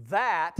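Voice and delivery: THAT punched out very loud; emphatic stress. Real, not acted. Preacher rejecting the youth group system, THAT stressed loudly.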